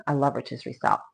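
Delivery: soft unvoiced whisper of delight; whispering to herself about the food; whispered aside. Real, not acted. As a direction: breathe it as a secret.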